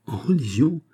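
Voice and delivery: high-pitched, swooping incredulous question; startled exclamation. A narrator's reading. King interrupting the ambassador's speech, startled cry. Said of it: "s'écria le roi au milieu du discours".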